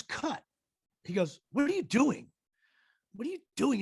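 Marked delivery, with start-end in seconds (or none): 0.0-0.4 high mock call of cut; retold yell. Director-star halts the scene, half-voiced yell. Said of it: "he yells". 1.5-3.8 high, insistent mock scolding; acted exasperation. Larry berates the actor after cut, mock exasperation.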